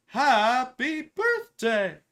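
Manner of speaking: loud, high, very drawn-out cartoon cheer; Frosty the Snowman. Client quoting the cartoon, loud drawn-out cheer. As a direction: stretch it out like an excited cartoon character. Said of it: "Remember he says".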